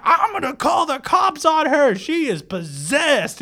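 high, drawn-out mock shouting; fighting neighbors. Dog owner threatening the woman during a street fight, yelling. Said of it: "they're just having a fight".

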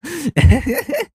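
high burst of laughter. Real, not acted. Vlogger laughing at her childhood story, high burst.

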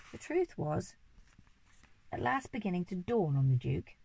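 plain reading voice

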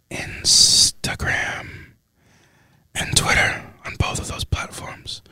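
breathy close-mic whisper, unhurried; ASMR whisper. Acted. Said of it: "A S M R".

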